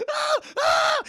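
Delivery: two loud, high acted yelps; repeated hammed-up high scream; mock screams of pain. [name] is nibbled on by Vivian, mock screams.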